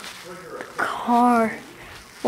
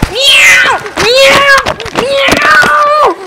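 high, loud mock cat cries; shrieked pretend meows; 12 semitones higher, 4 dB louder, much slower than usual. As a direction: meow loudly like an excited cat. Riding the cat, meowing.

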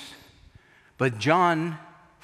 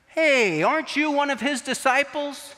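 accusing question; 9 semitones higher than usual. Retold or imitated. Bystander accusing Peter.

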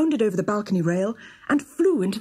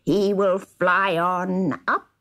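gloating dwarf. A narrator's reading. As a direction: relish every cruel word. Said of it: "sniggered the dwarf".

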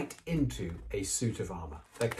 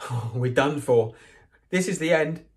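despairing; 6 semitones higher, 7 dB louder than usual. Acted. Ron despairing at locked door.

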